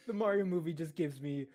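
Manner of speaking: ordinary talk, nothing marked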